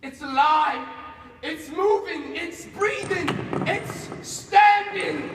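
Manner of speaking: loud, rising cries of triumph; mounting exultation. Acted. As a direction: build from loud to high, drawn-out yell. Creature gets up.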